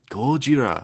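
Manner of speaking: a self-mocking Japanese accent on Gojira; movie-style Japanese accent. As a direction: imitate the guy from the movie saying Gojira. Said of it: "trying to mimic the guy from the movie".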